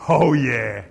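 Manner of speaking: emphatic Aussie "sparks fly", then a loud "oh, yeah"; Aussie exclamation. Shown as a demonstration. Preacher showing the congregation his Aussie side, emphatic Aussie exclamation. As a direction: punch it out with broad Aussie relish. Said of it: "That's the Aussie in me".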